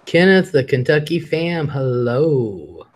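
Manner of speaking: ordinary delivery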